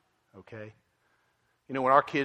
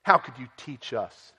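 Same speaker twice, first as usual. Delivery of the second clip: mad Pharisees; 5 semitones higher, 8 dB louder than usual. Retold or imitated. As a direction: scold the healed man as beneath you. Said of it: "And they get so mad".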